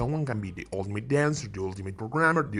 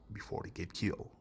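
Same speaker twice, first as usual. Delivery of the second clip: dark quiet aside; 6 semitones lower, 13 dB quieter, faster than usual. Acted. Warning viewers the characters die, hushed dark aside. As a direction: drop to a near-whisper, much lower and softer.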